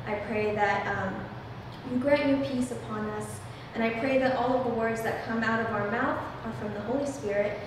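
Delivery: usual speaking voice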